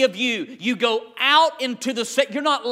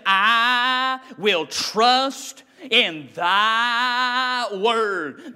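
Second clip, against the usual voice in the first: slow solemn vow; much slower than usual. Acted. Quoting David's vow.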